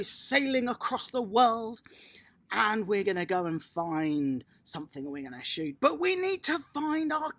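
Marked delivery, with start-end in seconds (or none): none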